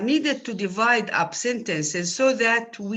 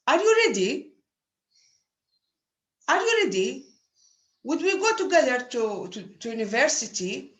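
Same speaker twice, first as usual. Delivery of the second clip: higher pitch with a rising question tune; question intonation; 4 semitones higher than usual. Shown as a demonstration. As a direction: ask it plainly, lifting at the end. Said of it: "When I ask a question in speech, I say".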